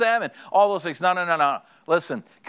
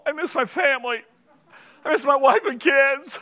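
high-pitched blubbering, hammed up; comic weepy wail; mock tearful whine; 9 semitones higher than usual. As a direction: imitate a homesick man falling apart. Burly men mock-crying for family.

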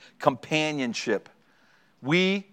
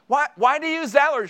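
imitating curious wife; 10 semitones higher than usual. Wife interrupting the show.

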